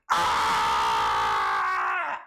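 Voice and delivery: deep, loud hammed-up yell of pain; low mock pained yell. Wrestler blinded by mist, a loud low mock yell.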